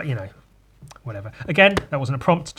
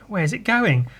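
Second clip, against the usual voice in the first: ordinary delivery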